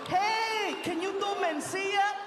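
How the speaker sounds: high, eager comedian impression; Paul Rodriguez. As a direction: ask as an excited fellow comic, high-pitched. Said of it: "I did that to Paul Rodriguez".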